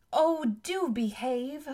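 slow, lightly voiced young girl chiding; girl's voice. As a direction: gently scold the wolf as a girl. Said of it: "a girl's voice said".

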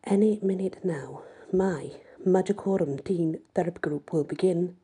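ordinary delivery, nothing marked

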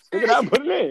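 mock teaching voice. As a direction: spell it out for a little kid pumping gas. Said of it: "like teaching a little one".

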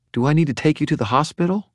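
put-on high, fast, anxious young woman; a fretting young woman asking about the hospital; Molly, worried. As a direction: rush it out in worry.

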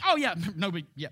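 loud, eager report of success; a hint of an excited shout; excited disciples. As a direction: burst in with excited news.

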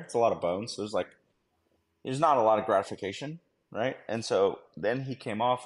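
ordinary talk, nothing marked

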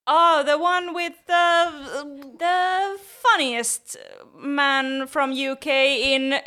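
drawn-out, slow host introduction; slow grand introduction. Pretend. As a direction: introduce him like a showman. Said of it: "go on, introduce me".

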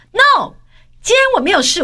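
high-pitched mimicked protest; mock friend's denial. Host retells friend's denial, high mock protest. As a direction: imitate a friend denying it in a high voice.

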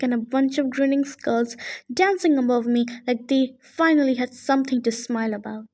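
plain reading voice

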